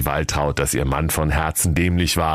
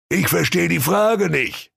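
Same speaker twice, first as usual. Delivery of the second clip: blustering king; 10 semitones higher than usual. Acted. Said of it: "polterte König Henner Kotelett ungehalten".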